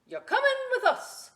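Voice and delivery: very high, loud pirate order; a captain claiming his new digger. Acted. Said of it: "Braid Beard told me".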